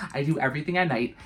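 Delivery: usual speaking voice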